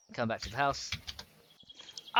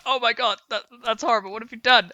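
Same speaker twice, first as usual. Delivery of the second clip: higher-pitched mock horror; horrified; 8 semitones higher than usual. Retelling a friend's reaction to the build, mock horrified. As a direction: imitate an appalled friend.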